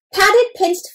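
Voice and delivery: ordinary delivery, nothing marked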